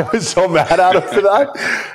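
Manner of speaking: laughing through the words. Real, not acted. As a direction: laugh it out while owning up to being mad. Laughing while recalling his anger.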